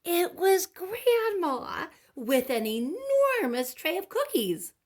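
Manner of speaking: high, loud, slow announcement; joyful reveal. A narrator's reading. Narrator reveals Grandma with cookies, high and loud. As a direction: announce it like the happy ending.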